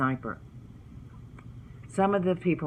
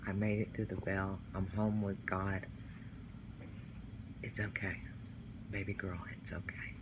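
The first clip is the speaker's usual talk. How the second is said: hushed, tender reassurance fading toward a whisper; soft comfort; 4 semitones lower, 9 dB quieter than usual. Retold or imitated. Nanny's message of being home with God, softly comforting. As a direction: soothe softly, dropping almost to a whisper.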